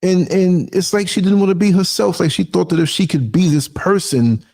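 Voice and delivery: usual speaking voice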